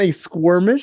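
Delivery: "squirmish" with a kw sound, skwur-mish; mispronounced word. Retold or imitated. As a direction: say "squirmish" with a questioning rise. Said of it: "as Izzy heard from someone".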